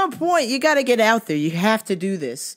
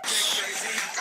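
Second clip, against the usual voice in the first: breathy whispered tag; 6 dB quieter than usual. Real, not acted. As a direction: drop it to a whisper. Shouted tag after greeting.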